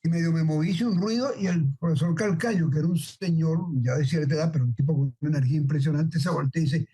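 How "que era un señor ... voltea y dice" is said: ordinary talk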